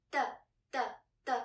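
"the, the, the", each short and separated; quick, cut-short "the" on each beat; short fifth tone. Shown as a demonstration. Teacher repeating the model for learners, short and fast. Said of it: "make the fifth sound short".

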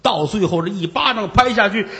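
normal talking voice

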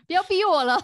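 mock pleading, loud and high; playful loud protest. Real, not acted. Learner begging the teacher to stop drilling, loud mock plea. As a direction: cry out jokingly under pressure. Pleading laughingly not to be pushed.

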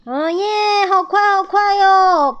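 high, loud thrilled whoop; excited shouting. A narrator's reading. Squirrels whooping on a fast sled, excited shout. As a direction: shout with glee, pitch way up. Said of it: "兴奋地大叫".

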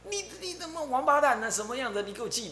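high mock curse at unseen spirits; woman cursing the spirits, voice pitched high; cursing at the air. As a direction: swear at the empty air. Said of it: "指着虚空痛骂".

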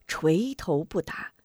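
plain reading voice

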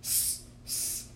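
"S-, s-," as a voiceless hiss; sounding out the letter. Shown as a demonstration. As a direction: breathe out "S-, s-," with no voice. Teacher sounds out first letter.

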